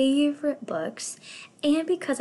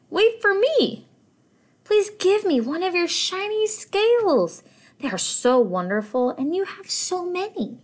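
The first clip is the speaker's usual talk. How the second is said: high, louder pleading little fish; 10 semitones higher, 5 dB louder than usual. Acted. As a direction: beg like a small eager fish. Little blue fish begs.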